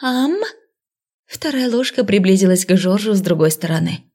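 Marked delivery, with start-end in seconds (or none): 0.0-0.3 high, slow sweet chirping; cartoon princess. A narrator's reading. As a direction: coo it like a sugary princess. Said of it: "удивительно ласково прощебетала".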